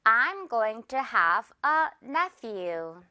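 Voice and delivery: I'm going to have a nephew, very slowly, each word apart; slow classroom model sentence; very slow demo. Shown as a demonstration. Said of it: "F 발음 주의하면서 갈게요".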